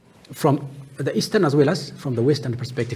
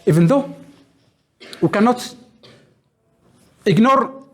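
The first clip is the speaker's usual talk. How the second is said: "even though we cannot ignore" pushed louder and higher; insistent emphasis; 6 semitones higher, 5 dB louder than usual. Real, not acted. Lecturer to students, insisting old questions still matter, emphatic. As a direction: drive home "cannot ignore" with raised voice.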